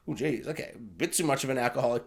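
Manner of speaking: low, soft acted muttering; uneasy aside. Viewer reacting to Quill's drinking, low uneasy aside. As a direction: drop it under the breath.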